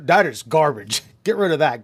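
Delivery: loud dismissive command; mock scornful order. Know-it-all coworker ordering the tool thrown out, loud.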